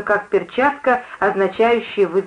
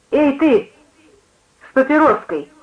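high exclamation at a stranger; calling out; 5 semitones higher than usual. A narrator's reading. Preacher singling out the smoker, calling out. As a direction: call out in a higher pitch. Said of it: "воскликнула проповедница".

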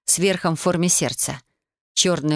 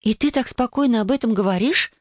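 indignant question, pitched up; 6 semitones higher than usual. A narrator's reading. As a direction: protest at the listener's calm. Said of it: "возмутилась я".

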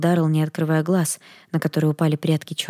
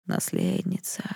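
a low, faint voice of the darkness calling; eerie spirit voice, much lower and softer; crackling dark voice; 11 semitones lower, 7 dB quieter, slower than usual. Acted. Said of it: "знакомый трескучий голос". (тьма зовёт наследницу, низкий трескучий голос)

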